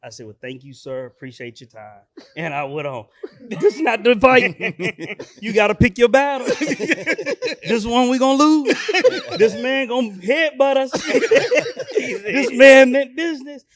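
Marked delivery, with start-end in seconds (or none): 0.3-2.0 low, soft polite retreat; meek quoted thanks. Retold or imitated. 3.5-13.6 loud, high, cracking up; laughing hard. Real, not acted. Comic punchlines, laughing throughout.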